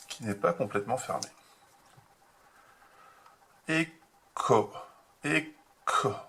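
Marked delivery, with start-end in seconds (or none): 3.7-6.1 "Éco" sounded out slowly, syllable by syllable; sounding out syllables. Shown as a demonstration. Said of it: "Le o pour faire o". (« éco » détaché, syllabe par syllabe)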